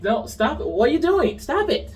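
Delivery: high, insistent mock protest; a lightly voiced sister telling her to stop; calling out a sister. Sister scolding her twin, mildly acted protest. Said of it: "Osha calls her out on this".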